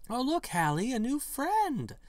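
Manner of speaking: put-on high, slow, dreamy little-girl voice; glassy-eyed girlish voice. Creepy woman in girl's clothes greeting the newcomer, high and dreamy. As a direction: coo it like a doll come to life. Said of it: "she says, her eyes glassy".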